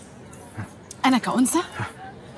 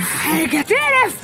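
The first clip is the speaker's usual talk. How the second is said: disgusted, drawn out; 6 semitones higher, 4 dB louder, much slower than usual. Acted. Disgusted outburst.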